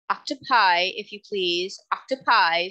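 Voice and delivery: plain reading voice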